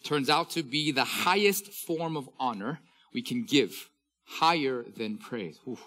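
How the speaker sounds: preacher reading a professor's quote with fervour; preaching quote reading. Acted. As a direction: press each phrase home to the congregation.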